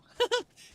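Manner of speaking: high burst of giggling; ticklish giggle. Real, not acted. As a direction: giggle helplessly. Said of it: "it's tickling me".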